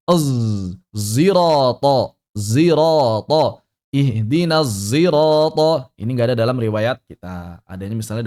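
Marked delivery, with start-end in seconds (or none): 0.1-3.6 thick buzzed z in "zirata", vowels drawn out; slow, emphatic "zirata" with a heavy z; sod blended with zai. Shown as a demonstration. Teacher models blended letter from recitation, sod blended with zai. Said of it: "sod yang digabung dengan zai". 3.9-5.9 slow recitation, thick buzzed z in "zirata"; sod blended with zai. Shown as a demonstration. Teacher recites example of another reading, sod blended with zai. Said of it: "sod yang digabung dengan zai".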